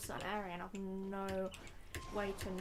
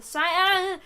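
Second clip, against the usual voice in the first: sudden loud outburst; 5 semitones higher, 11 dB louder, slower than usual. Real, not acted.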